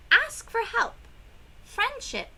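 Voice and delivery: high and loud plea; emphatic call. Acted. Teacher urging sad children to ask for help.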